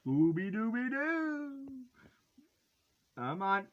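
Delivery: ordinary delivery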